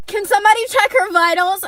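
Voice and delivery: high, loud imitation of a nurse; retold alarmed call. Nurse in waiting room spots a reaction, urgent call.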